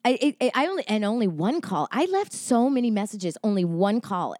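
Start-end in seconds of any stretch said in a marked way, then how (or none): none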